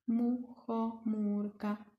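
"muchomůrka" said softly and slowly, counting syllables. Shown as a demonstration. Teacher counts syllables of "muchomůrka" softly for the kids. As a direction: count the beats quietly. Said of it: "Kolik to bylo slabik?".

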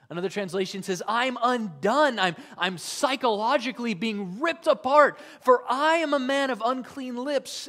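high and loud, torn apart; Isaiah in anguish before the King; anguished cry. Acted. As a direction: cry out as if being ripped apart. Isaiah undone before God.

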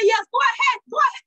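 high-pitched, defiant go-ahead; retold anger. Nurse telling her client to find someone else, irritated. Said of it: "I was so angry".

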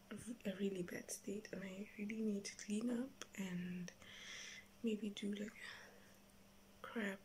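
hushed whisper. Real, not acted. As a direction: keep it down, whispered. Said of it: "I have to whisper".

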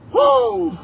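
high, loud whooping cheer; gleeful whoop. Real, not acted. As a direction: let out a joyful woo.

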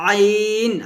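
আইন pushed out with throat pressure; clearly pressed আইন; pressed ain. Shown as a demonstration. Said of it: "চাপ দিয়ে উচ্চারণ করতে হয়".

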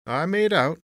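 high, loud, stretched-out drawl; yawning drawl. A narrator's reading. Clerk brushes off Tony's warning, drawling. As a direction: draw it out through a yawn. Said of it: "the clerk drawled and completed his yawn".

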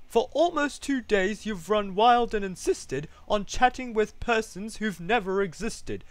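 high, indignant scolding; haughty, cross kangaroo complaint; snapping kangaroo. A narrator's reading. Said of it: "snapped the big kangaroo".

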